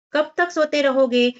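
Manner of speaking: narrated motherly calling-out; calling out loudly. A narrator's reading. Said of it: "तभी माँ ने जोर से पुकारा".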